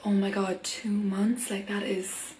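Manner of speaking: mock whisper. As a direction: hush it like a tragic secret. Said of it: "it sounded like".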